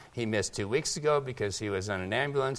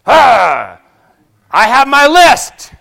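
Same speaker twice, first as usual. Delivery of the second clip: mock pushy busybody, higher and much louder; 11 semitones higher, 21 dB louder, much slower than usual. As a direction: play an overeager nosy churchgoer with a checklist. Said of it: "you come up to someone and you go".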